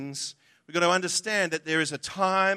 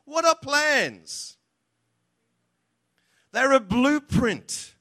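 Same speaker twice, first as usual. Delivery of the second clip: ordinary delivery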